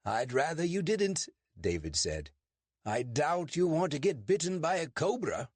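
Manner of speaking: David's put-on British accent, pitched high. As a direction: fake a polite British accent to fool a constable.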